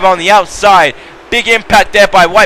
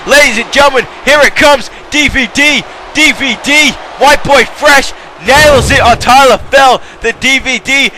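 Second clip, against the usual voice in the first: excited call; 5 semitones higher than usual. Real, not acted. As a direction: announce the finisher like it ends the match. Calling the finishing DVD.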